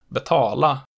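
put-on betala in Old Stockholm dialect with accent two. Shown as a demonstration. Teacher showing century-old local speech, Old Stockholm accent two on betala. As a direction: give betala the Old Stockholm double-peak tone. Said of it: "generalized accent two onto everything else".

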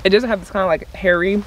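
chewing between words; talking while chewing. Real, not acted. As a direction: mouth partly full of seaweed. Seen 1: put seaweed in mouth at -5, jaw working, hand at mouth.

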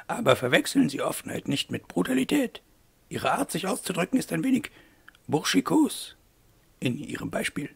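high, lilting put-on lady's voice; reproving mademoiselle. A narrator's reading. Eloire reproves Wolf for his bluntness, high prim lady voice. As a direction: gently reprove him in a high character voice.

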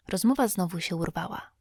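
plain reading voice

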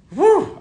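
a very high, very loud whoop of joy; joyful whoop. Real, not acted. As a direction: let out a real cheer of delight. Overjoyed about the reveal.

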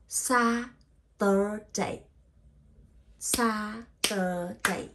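"Saturday" slowly in three separated, stretched parts, twice; syllable by syllable. Shown as a demonstration. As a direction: sound out SA, TUR, DAY with gaps. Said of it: "three syllable".

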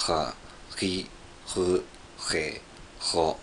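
"Ha, ri, ru, he, ro" with a rough, fricative throat R; struggling French R, scraped in the throat on "Ha, ri, ru, he, ro"; attempted French R. Shown as a demonstration. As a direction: practise "Ha, ri, ru, he, ro" as separate French-R syllables. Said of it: "La prononciation de R français est très difficile".

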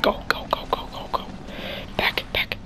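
hurried, scared whisper; urgent whisper. Real, not acted. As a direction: rush it under your breath.

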